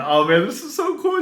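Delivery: high, thrilled newbie exclaiming to himself; excited inner thought. Retold or imitated. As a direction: gush like it's your first day. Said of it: "And I just remember thinking".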